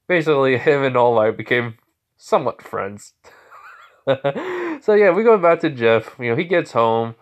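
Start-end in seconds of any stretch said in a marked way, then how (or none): 4.0-4.7 short, high real chuckle; amused giggle. Real, not acted. Storyteller amused by villain and hero as friends, high giggle. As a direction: giggle briefly at your own story.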